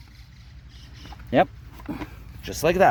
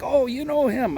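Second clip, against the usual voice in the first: much higher, impressed question; imitated surprise; 10 semitones higher than usual. Imitating the driver asking about a hockey player, surprised. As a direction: mimic an amazed question.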